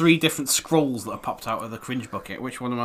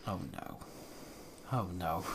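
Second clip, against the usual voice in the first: hushed; 7 semitones lower, 18 dB quieter than usual. Real, not acted. Aside while reading.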